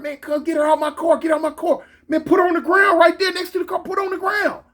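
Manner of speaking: high, frantic rapid-fire orders; acted yelling, urgent and insistent; retold hollering. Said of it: "I'm hollering".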